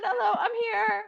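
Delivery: very high, teary hello I'm here; tearful hello. Retold or imitated. Inner self greeting her in meditation, high and near tears. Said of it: "It's like".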